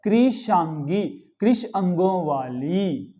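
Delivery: कृषांगी slowly, syllable by syllable; correct form. Shown as a demonstration. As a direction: stretch कृषांगी and its gloss with long final vowels. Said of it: "शुद्ध क्या होता है?". (कृषांगी का धीमा, शुद्ध उच्चारण)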